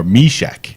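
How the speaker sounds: Meshek with a long "ee" in the first syllable, MEE-shek; correct pronunciation. Shown as a demonstration. Player insisting on their character's name, Meshek said carefully as MEE-shek. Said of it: "I'm saying it right".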